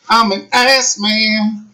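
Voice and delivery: slow drawn-out mocking repeat; mocking echo. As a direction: stretch it out to mock him. Echoes the line mockingly.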